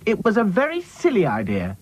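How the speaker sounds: high, bossy reproof; scolding. A narrator's reading. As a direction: tick off the gloomy doll. Dotty scolds Sad Sack.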